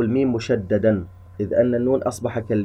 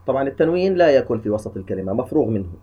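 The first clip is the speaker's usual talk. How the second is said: ordinary talk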